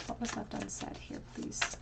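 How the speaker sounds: soft secretive whisper; whispered question. Real, not acted. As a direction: hush right down and whisper the question. Asks the cards, hushed question.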